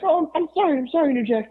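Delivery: very high, nervous placating voice; mock Howard. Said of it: "and Howard's like".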